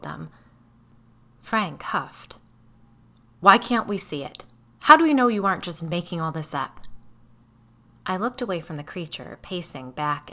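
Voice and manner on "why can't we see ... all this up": much louder, fast, challenging demand; angry accusing. Acted. Frank accusing the narrator, loud and fast. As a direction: fire the doubt back at her.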